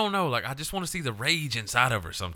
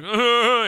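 long, high, loud groan; 12 semitones higher, 7 dB louder than usual. Retold or imitated. Acting out getting stuck in his head, loud high groan. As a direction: let out a drawn-out mock groan.

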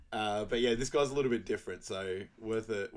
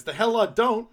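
sudden loud snap, higher; angry outburst; 12 semitones higher, 11 dB louder than usual. Retold or imitated. Kareem snapping at Joey, loud angry outburst. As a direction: bark it back in offended rage. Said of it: "He goes".

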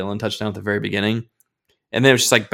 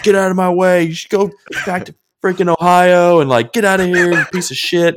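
high, loud mock yell at rival fans; retold angry yelling; 9 semitones higher, 6 dB louder than usual. Fan yelling at visiting fans on the way out, in a loud mock yell. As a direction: bark it at the fans like you're furious. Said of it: "dropping F-bombs at everybody".

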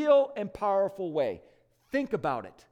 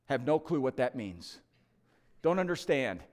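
humble thief; 6 semitones lower than usual. Retold or imitated. Thief answering the angel.

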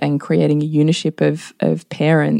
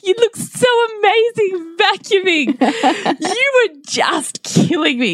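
higher, slower gushing mock compliment; hammed-up praise. Mother teasing her son about his vacuuming, hammed-up gushing. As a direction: lay the praise on thick to tease. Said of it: "Did you ham it up".

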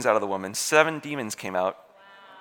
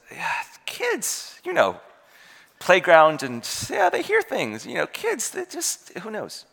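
dismissive pastor; 6 semitones higher, 3 dB louder, slower than usual. Retold or imitated. Pastor brushes off mother's worry.

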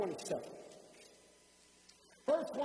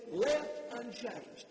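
ordinary talk, nothing marked

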